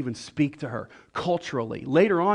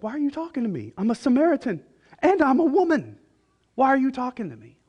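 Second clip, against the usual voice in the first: high-pitched mock astonishment, building louder; acted incredulous woman; 10 semitones higher, 4 dB louder than usual. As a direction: play her baffled, voice climbing to a peak. Acting the startled Samaritan woman.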